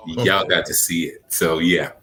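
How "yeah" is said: usual speaking voice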